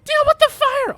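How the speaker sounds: shrieking high goblin yelling orders; goblin alarm shout. Acted. Goblin rider shouting to watchtower, shrill screeching alarm. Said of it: "they're just like".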